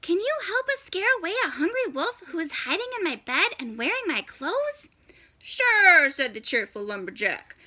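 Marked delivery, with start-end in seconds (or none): 0.0-4.9 fast, higher hushed plea; urgent old lady asking for help; quick worried granny; hurried grandma. Acted. Grandma asks lumberjack, hiding. 5.4-6.1 cheerful lumberjack. Acted.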